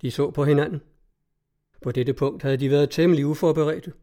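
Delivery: usual speaking voice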